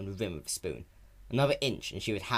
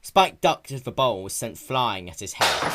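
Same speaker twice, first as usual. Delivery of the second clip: usual speaking voice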